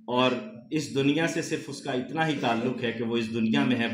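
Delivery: normal talking voice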